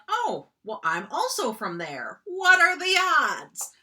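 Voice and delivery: high, loud amazed "what are the odds"; hammed-up delighted surprise; mock surprise. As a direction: act startled by the coincidence. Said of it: "A coincidence is like".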